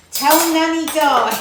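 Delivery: slow drawn-out fan request; fans calling out. Retold or imitated. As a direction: call it out, lingering. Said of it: "they say".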